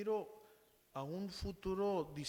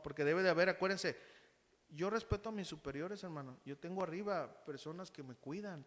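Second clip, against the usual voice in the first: ordinary talk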